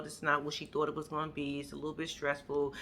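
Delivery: low, soft voice of a woman confiding; subdued retold confiding. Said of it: "And she just say".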